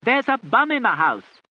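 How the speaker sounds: loud, high, slow yell. A narrator's reading. Patch yelling the news to the aunt, loud. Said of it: "yelled Patch".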